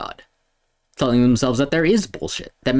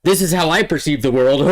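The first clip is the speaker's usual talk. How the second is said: mocking player voice; 5 semitones higher, 4 dB louder, faster than usual. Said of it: "Who is like".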